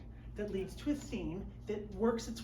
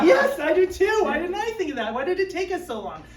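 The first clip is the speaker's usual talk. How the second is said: higher, louder gushing through laughter; overjoyed at the brilliant idea; laughing, hammed-up enthusiasm; mock delight; 9 semitones higher, 11 dB louder than usual. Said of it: "you're just like".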